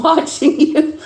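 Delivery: words squeezed out through high giggles; can barely talk for laughing; laughing hard. Real, not acted. Reader cracking up at the cards.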